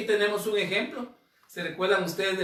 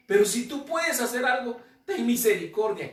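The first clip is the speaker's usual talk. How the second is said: pleading, pitched up and louder; a father begging Jesus for mercy; 6 semitones higher, 4 dB louder than usual. Shown as a demonstration. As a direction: beg for mercy with urgency. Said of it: "clamando su misericordia".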